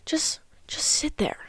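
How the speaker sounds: near-whispered coaching aside; hushed. Shown as a demonstration. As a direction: drop to a whisper, as if sitting in silence. Coaching how to hold awkward silence.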